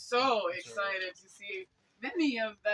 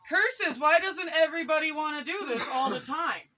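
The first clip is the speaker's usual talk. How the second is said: higher, louder mock complaint; mock exasperation; 6 semitones higher, 4 dB louder than usual. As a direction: playfully protest to the heavens. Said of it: "so part of me is like".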